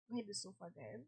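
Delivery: worn-out, fading voice; tired voice. Real, not acted. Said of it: "long week and long day".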